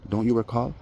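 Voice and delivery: ordinary talk, nothing marked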